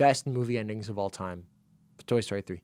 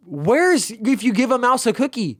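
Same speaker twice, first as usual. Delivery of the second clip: high, loud exaggerated complaining voice; mock outraged rant; 10 semitones higher, 5 dB louder than usual. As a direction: pitch it way up like a scandalised kid-book fan. Mock rant about kids' books.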